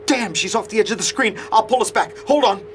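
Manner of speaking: high, agitated muttering while fixing things; flustered and urgent; frantic. Acted. Frantic to restore lost picture.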